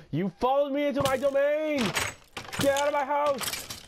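high, loud territorial yell; mock outraged shout. Gamer yelling at an intruder in his house, mock outrage.

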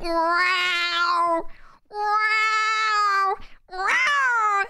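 long high yowls of cats mating; drawn-out feline caterwauling; imitated mating-cat yowls. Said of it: "sound of cats, um, procreating".

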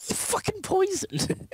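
high laughing squeal; laughing outrage. Real, not acted.

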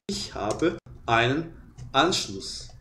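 slow, soft "ich habe einen Anschluss"; modeling the phrase for students; slow German demo. Shown as a demonstration. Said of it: "é".

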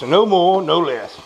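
ordinary talk, nothing marked

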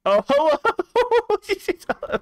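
very high, loud, stretched hello; squealing hello. Real, not acted. Streamer greets a tiny avatar with a stretched squeal. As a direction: coo over something adorable.

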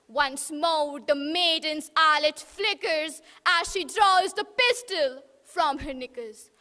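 ordinary delivery

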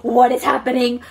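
loud, hammed-up panicked cry; dramatic panic. Vlogger retelling thinking they were dying, dramatic panic. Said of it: "I am the most dramatic ass bitch".

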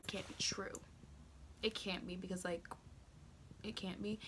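whispered soft denial; barely voiced, in shock; quiet disbelief; stunned whisper.

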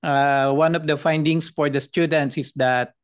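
usual speaking voice

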